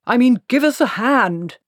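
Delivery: loud, high, impatient snap; snapped. Acted. Cutangle in the water snapping at Treatle, loud and impatient. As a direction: snap the order out. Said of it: "snapped Cutangle".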